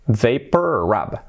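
Vapor Rub very slowly, ending on a voiced B; English Vapor Rub with the final B sounded. Shown as a demonstration. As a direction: stretch out "VAY-per rub". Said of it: "Acabado en B".

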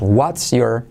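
"what's your" as two separate words; unlinked what's your. Shown as a demonstration. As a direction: keep the S and Y apart in "what's your". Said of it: "aquí no lo unimos". ("what's your" sin unir, palabras separadas)

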